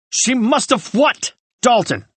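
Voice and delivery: angry demand. A narrator's reading. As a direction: drag out the what, demanding. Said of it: "Ryan shouted".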